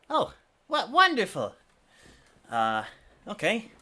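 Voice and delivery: high, bright-eyed merchant delight; delighted shopkeeper. Acted. Merchant pleased with the offered payment, high and delighted. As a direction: sound thrilled at the price.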